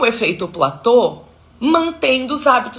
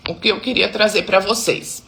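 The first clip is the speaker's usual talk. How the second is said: usual speaking voice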